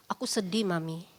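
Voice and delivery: soft, low little sad voice; sad child. Retold or imitated. Parent quotes a sad child. (suara anak sedih yang pelan)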